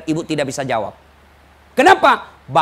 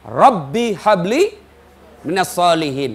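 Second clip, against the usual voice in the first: very slow recitation of the prayer; recited prayer; slower than usual. Acted. Preacher reciting Ibrahim's prayer for a pious child, slow and reverent. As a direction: draw the Arabic words out reverently.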